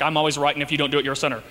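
lightly voiced stern God; stern Lord. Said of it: "And he's like".

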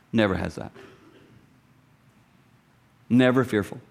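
normal talking voice

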